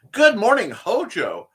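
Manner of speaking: loud, high, cheerful hello; excited greeting. Real, not acted. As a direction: boom out a happy good morning. Louder enthusiastic repeat greeting.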